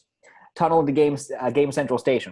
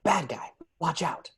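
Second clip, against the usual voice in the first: higher hushed whisper; whispered warning; 4 semitones higher, 4 dB quieter, slower than usual. A narrator's reading. Bystanders whispering as Ralph walks by, hushed warning. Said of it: "whisper things like".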